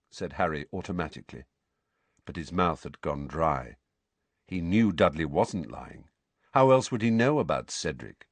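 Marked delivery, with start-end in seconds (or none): none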